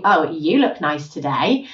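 slow, low, loaded compliment; backhanded compliment. Shown as a demonstration. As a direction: drag the words out low so the praise sounds doubtful. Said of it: "they're saying something very different".

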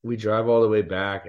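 usual speaking voice

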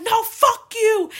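high, loud, drawn-out defiant yell; screamed retort. Retold or imitated. Daughter screaming back at her dad in the street, retold. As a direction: scream it back, acting out the fight. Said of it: "And I'm screaming".

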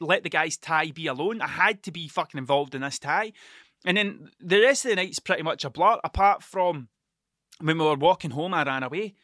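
ordinary delivery

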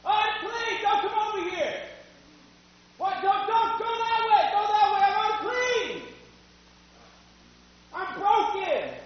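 high-pitched yell as if from far off; distant, high, strained calling out. Acted. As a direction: shout a warning to keep people well away. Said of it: "I had to yell".

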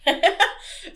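high, real giggling laugh. Real, not acted. Host laughing at her co-host's condom pun.

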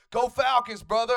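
fan cheer. Retold or imitated. Comedian shouting at a stranger in the dog park, loud cheer. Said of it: "I'm like".

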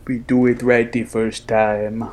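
badly faked Schwarzenegger accent; a hammed-up action hero accent; bad Schwarzenegger impression; horrible accent. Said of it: "That was a horrible accent".

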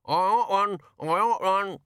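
high muffled raven voice, beak full. A narrator's reading. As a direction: talk through a beakful of egg. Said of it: "a muffled voice".

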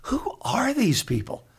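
high, puzzled wondering to himself; mock baffled aside; a hint of disbelief; bewildered inner voice. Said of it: "I'm going".

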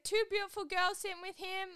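high-pitched mock British lad; put-on UK accent, pitched right up; bad UK accent. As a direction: imitate an incredulous Manchester accent. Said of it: "my U K accent is not good".

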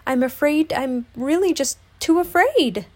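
high, anxious, timid confession; nervous stammer. A narrator's reading. Piglet excusing himself to his friends, nervously. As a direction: plead nervously in a small high voice. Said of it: "he stammered nervously".

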